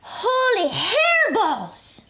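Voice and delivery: high, slow, amazed cry; excited exclamation. A narrator's reading. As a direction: shout it in wonder. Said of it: "exclaimed Skippy John Jones".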